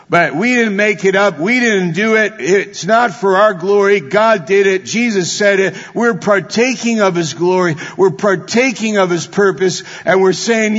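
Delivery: preaching at full force. Acted. Preacher rousing the congregation, full-force preaching. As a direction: build the sermon to its peak.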